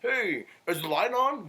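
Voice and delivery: high, slow, silly mock voice; mocking dumb question. Mimicking his cousin's dumb question in a high, slow mock voice. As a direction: parody a clueless question. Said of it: "mocking the cousin's question".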